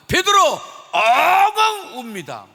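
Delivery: loud, slow wailing shout; drawn-out loud lament; wailing cry. Acted. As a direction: cry it out like Peter weeping. Peter weeps bitterly.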